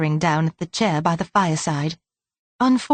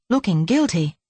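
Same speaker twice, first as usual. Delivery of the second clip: ordinary delivery, nothing marked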